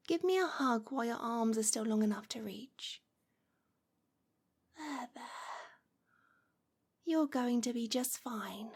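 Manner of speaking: hushed, intimate witch speaking close to the ear; gentle hypnotic murmur to a new familiar. Acted. Soft roleplay voice throughout.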